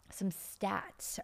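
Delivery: whispered aside. Real, not acted. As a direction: drop to a whisper for this.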